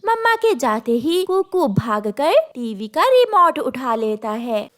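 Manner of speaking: ordinary delivery, nothing marked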